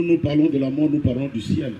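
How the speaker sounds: ordinary talk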